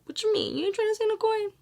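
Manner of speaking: high-pitched sassy challenge, played up; mock challenge. Vlogger teasing viewers about saving coin, mock challenge. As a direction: squeak out a mock-indignant question.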